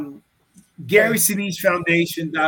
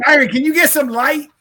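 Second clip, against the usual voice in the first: calling out; 11 semitones higher, 11 dB louder than usual. Real, not acted. Calling to Byron off-mic.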